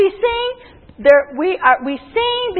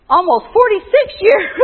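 laughing through the words; amused, words dissolving into laughter; 2 semitones higher than usual. Real, not acted. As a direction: break into a laugh at your own age. Amused at her own miscount.

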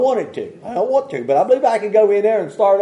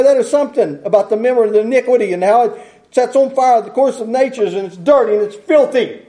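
ordinary talk

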